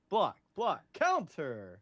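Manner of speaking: loud, punchy move callouts; mock fight calls. Co-host acts out the fight, playful shouting. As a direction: call each move like a blow.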